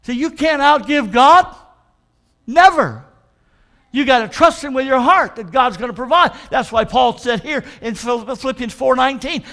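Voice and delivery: loud, slow, drawn-out preaching; full-force preaching. Acted. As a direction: preach it out to the congregation.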